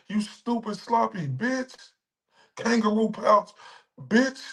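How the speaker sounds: high-pitched outraged insults; furious rant. Real, not acted. Streamer raging at the accuser, high and outraged. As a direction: let it rip, voice way up.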